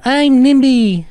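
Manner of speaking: loud, very high drawn-out doll voice; high, slow, loud toy voice; Nimby the doll. Acted. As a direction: squeal it out like a talking doll. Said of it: "it literally was gonna be like".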